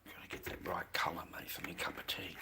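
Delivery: muttered under the breath, voiceless; whisper. Real, not acted. Streamer whispering to himself about his cup of tea. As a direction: whisper it to yourself.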